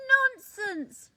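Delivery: loud, high indignant shout. A narrator's reading. Top Leaf shouting at the small leaf, loud. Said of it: "shouted Top Leaf".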